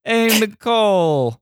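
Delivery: high, slow Noo Yawk "hey"; New York accent. Pretend. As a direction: call out like a street New Yorker. Mock New Yorker voice.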